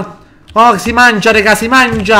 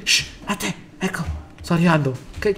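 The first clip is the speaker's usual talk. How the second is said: much softer hushed whisper; 14 dB quieter than usual. Real, not acted. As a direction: hush it, sneaking close. Sneaking up to revive teammate.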